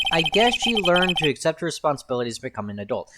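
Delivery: normal talking voice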